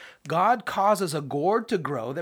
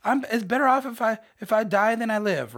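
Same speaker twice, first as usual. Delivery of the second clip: whiny death wish; 7 semitones higher, 4 dB louder than usual. Acted. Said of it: "He's like".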